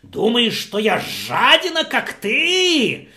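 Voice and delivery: tremble with rage and shout him down; angry host yelling. Acted. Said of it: "и он закричал".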